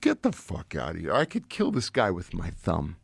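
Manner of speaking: low, slow tough-guy scoff; dismissive tough talk, low; scoffing disbelief. Retold or imitated. Own retold scoffing reply.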